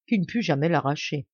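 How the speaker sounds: ordinary delivery, nothing marked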